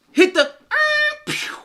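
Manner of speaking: high, slow gunshot pew; mock gunshot sound; starter pistol pew. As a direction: imitate the starting gun. Imitating a starting gun.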